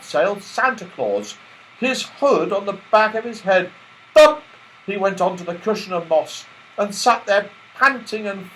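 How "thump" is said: high, loud punched sound word; loud thump. A narrator's reading. Narrator marking Santa's landing, loud thump. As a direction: bang it out.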